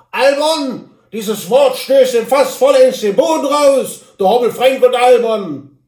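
high, loud, pompous little man in a huff; indignant Hobble Frank. Acted. Hobble Frank answers from his horse, loud and offended. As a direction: declaim your offended dignity loudly.

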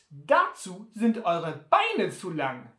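höher, lauter und langsam ungläubig; Sam ungläubig. Acted. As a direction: zweifelnd und gedehnt wie Sam. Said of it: "fragte dann ungläubig". (Sam zweifelt laut und langsam an Butler)

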